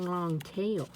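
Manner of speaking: usual speaking voice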